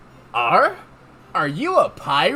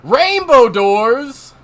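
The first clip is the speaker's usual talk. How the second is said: excited; 5 semitones higher, 9 dB louder, much slower than usual. Acted. Announcing the doors.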